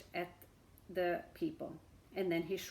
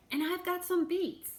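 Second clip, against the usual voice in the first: townsperson calling; 9 semitones higher, 3 dB louder than usual. A narrator's reading. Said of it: "called another".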